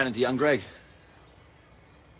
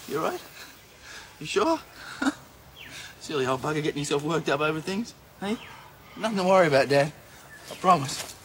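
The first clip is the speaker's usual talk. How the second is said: bright reassurance; 8 semitones higher, 4 dB louder than usual. Acted. Reassuring worried father.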